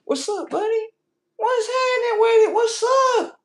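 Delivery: very high squeal building to a shout; high-pitched pet greeting. Retold or imitated. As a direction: greet a cat in excited falsetto. Greeting the cat in a put-on voice.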